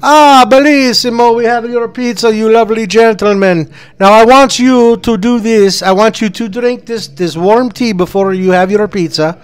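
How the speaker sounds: high, eager Italian waiter. Acted. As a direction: play a jolly pizza waiter. S0 plays Salvadori again.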